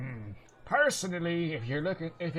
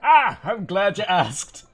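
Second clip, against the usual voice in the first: slow, louder, delighted dwarf voice; eager dwarf; 5 semitones higher, 9 dB louder than usual. Acted. Dwarf answering about gnome rations, eager and loud. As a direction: relish the question.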